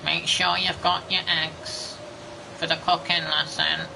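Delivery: high, slow, brassy teacher voice; shrill cooking teacher impression; trumpet-voiced teacher. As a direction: sound like a trumpet stuck in the throat. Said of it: "she had, like, a trumpet stuck in her throat".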